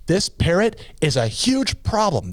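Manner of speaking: slow, high-pitched indignation; furious complaint. Acted. Woman complaining to the store owner, mock-furious and slow. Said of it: "She's furious".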